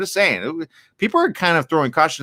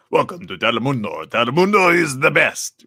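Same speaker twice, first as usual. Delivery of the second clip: lightly voiced Spanish TV announcer; a hint of Telemundo promo voice; mild mock Spanish-language station announcer. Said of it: "Like, like that".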